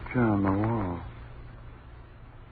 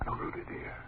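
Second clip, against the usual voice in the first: frozen, frightened whisper rising high; paralysed whisper; 10 semitones higher, 5 dB quieter than usual. Acted. Husband unable to move while the killing happens, paralysed whisper. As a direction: whisper rooted to the spot.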